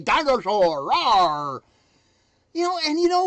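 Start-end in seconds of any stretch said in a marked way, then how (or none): 0.0-1.6 dinosaur roar. Pretend. Motivational dinosaur roar chant.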